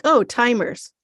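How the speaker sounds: clueless shrug of a reply; dim-witted. Retold or imitated. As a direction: say it like it's nothing. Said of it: "And they're like".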